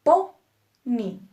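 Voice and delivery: "Po-ni" split, loud PO, then ni; stressed first syllable. Shown as a demonstration. Teacher showing the accented syllable, "Po-ni" split with PO stressed. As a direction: punch PO loudly in "Po-ni", pause, then ni.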